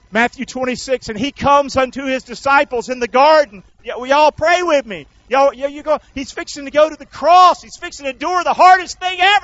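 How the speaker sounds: loud, high-pitched sermon delivery; full-force preaching. Real, not acted. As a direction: drive the Bible story home to the congregation. Preaches the garden scene at full force.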